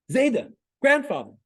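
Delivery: put-on high, loud call of a small boy across the room; child's scream. Young grandson calling grandfather from the corner, high loud scream. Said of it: "suddenly he screams".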